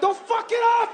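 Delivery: high, loud shouted warning; parent's shout. Retold or imitated. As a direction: bark it at the son like a strict parent.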